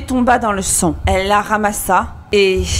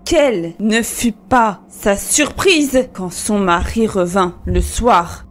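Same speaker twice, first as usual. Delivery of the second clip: ordinary delivery, nothing marked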